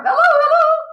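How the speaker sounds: high, loud parrot hello; parrot calling. Acted. Parrot in the chimney calling down to Matilda, high and loud. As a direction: squawk it down the chimney. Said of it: "the bird called down to her".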